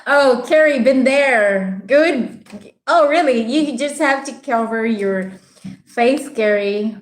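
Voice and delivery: ordinary delivery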